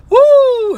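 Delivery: high, loud whooping woo; excited woo. Real, not acted. Whooping to viewers over the burn. Laughing at Vocab getting hurt.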